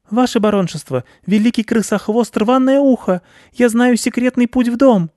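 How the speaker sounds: very high squeaky mouse voice; little mouse courting a baron's favour; timid, flattering mouse. Acted. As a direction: pipe up eagerly as a tiny mouse.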